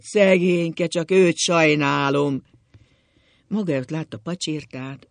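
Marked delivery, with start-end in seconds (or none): 0.0-2.4 loud, slow, drawn-out wail; wailing lament.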